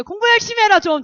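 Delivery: 높고 크게 내지르는 잔소리; 답답한 듯 버럭 소리치기; 아이들 꾸중. Acted. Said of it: "우리 아이들한테 얘기해요". (아이들을 다그치는 고함)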